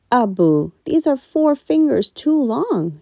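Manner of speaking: high, slow, loud; mild whiny protest; daughter's complaint. A narrator's reading.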